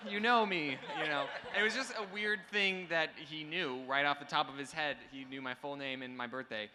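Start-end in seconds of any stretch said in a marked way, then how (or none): 0.1-0.8 loud, high, slow surprise; mock surprise. Youtuber reacting to a fan who knows his birthday, loud mock surprise. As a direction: act floored that he knows you. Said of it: "And I'm like".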